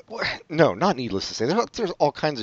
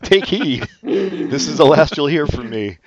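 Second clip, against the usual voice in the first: dramatic ghost voice; 3 semitones higher, 5 dB louder, slower than usual. Retold or imitated.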